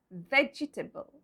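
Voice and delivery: VEGETABLE in four syllables, vej-ih-tuh-bul; spelling pronunciation. Shown as a demonstration. Teacher shows the mistaken way, VEGETABLE as vej-ih-tuh-bul. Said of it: "We go by the spellings".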